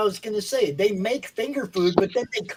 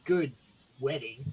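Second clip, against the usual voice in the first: sleazy ad voice; 3 semitones lower, 5 dB louder than usual. Acted. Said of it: "I feel violated".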